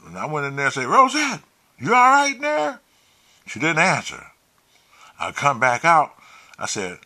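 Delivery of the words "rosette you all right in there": high, loud call into another room; retold worried shout-out. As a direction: call out to check on her. Calling to someone inside, retold.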